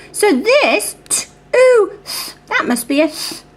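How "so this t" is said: usual speaking voice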